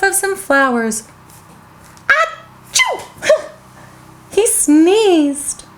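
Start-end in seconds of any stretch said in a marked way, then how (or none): 2.1-3.5 high pretend sneeze; fake sneeze. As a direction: act out a big sneeze. Character sneeze in story.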